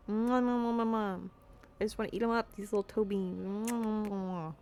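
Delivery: rapid smacking kisses; kiss sounds. Real, not acted. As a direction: smooch the toe beans. Kissing cat toes.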